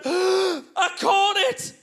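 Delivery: high, drawn-out mock wail; horrified confession. Wife admitting to husband she caught it, horrified. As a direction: confess it slowly in high horror.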